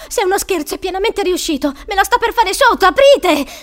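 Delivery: high, loud desperate protest; panicked yelling. Acted. Trapped boy pleading with supposed pranksters, panicked yelling. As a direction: yell it in rising panic, ending in a shout. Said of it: "urlò".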